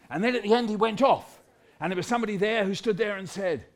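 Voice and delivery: ordinary delivery, nothing marked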